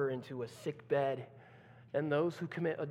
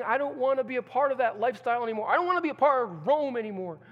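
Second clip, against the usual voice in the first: urgent pleading; 6 semitones higher, 7 dB louder, faster than usual. Acted. Convert voiced quickly.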